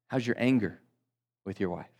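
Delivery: usual speaking voice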